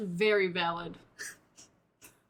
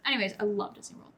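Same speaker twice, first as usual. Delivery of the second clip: ordinary delivery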